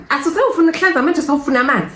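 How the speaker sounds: high mocking nurse voice. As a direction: throw the nurse's sarcasm back at her. Nurse's irritated retort again.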